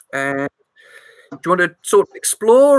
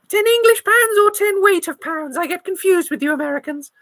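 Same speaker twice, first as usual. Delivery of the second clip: put-on high old English lady; fussy granny, puzzled; 9 semitones higher than usual. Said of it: "my old granny voice".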